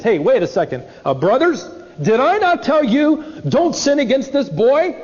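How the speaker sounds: defensive, rising pitch "did I not tell you"; a brother shifting the blame; mock self-righteous whine; self-righteous protest. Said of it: "self-righteous".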